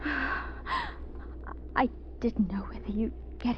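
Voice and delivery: slow shaken whisper. Acted. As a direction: whisper it slowly, still shaken. Shaken after rescue.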